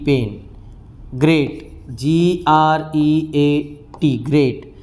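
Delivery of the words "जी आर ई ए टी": slow spelling. Shown as a demonstration. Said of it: "spelling out letters".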